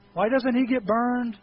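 ordinary delivery

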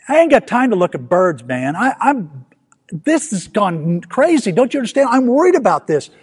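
playing his own panic at Jesus; mock frantic protest. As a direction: push back like a man too worried to listen. Arguing with Jesus in the story.

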